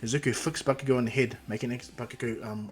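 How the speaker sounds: plain reading voice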